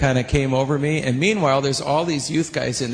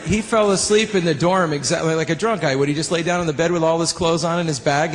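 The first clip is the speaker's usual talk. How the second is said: ordinary delivery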